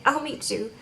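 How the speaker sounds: a lightly voiced eager girlfriend; high and soft, coy yes; imitating Lana. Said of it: "And she's like".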